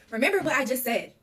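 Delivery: loud, high urgent command; a retold forceful reminder from Nana; urgent insisting. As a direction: drill it into them urgently. Nana insisting, hiding the evidence.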